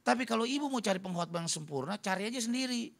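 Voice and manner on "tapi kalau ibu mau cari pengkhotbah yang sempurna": usual speaking voice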